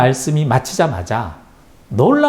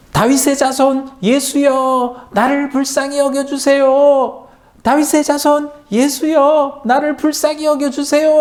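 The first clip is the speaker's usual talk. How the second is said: crying out; 13 semitones higher, 3 dB louder than usual. A narrator's reading. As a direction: call out to Jesus across the crowd, begging. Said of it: "이렇게 외쳤어요".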